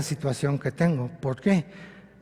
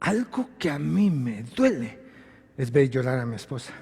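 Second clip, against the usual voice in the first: sorrowful; 4 semitones lower, 7 dB quieter than usual. Real, not acted. Husband pained by his wife's tears, softly sorrowful. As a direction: speak as if it hurts to say. Grief over wife's crying.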